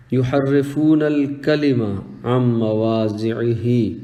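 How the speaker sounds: slow, drawn-out Arabic recitation; measured scripture quotation; Quranic recitation. Acted. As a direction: recite the verse slowly with long vowels.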